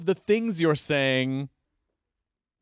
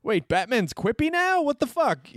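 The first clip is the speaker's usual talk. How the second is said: incredulous; 8 semitones higher than usual. Retold or imitated. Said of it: "where it's like".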